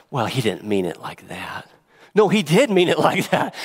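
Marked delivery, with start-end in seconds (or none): 0.1-1.7 mock whispered excuse; whispered excuse for Paul. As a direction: breathe it like a sly aside. Mocking those explaining away.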